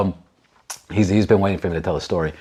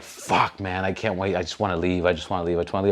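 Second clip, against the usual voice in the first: weary; 5 semitones lower, 6 dB quieter than usual. Retold or imitated. Weary wish to leave.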